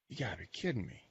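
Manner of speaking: softly muttered mock disbelief; retold quiet double-take; mild disbelief. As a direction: say it under your breath, incredulous. Stunned reaction to caller's name.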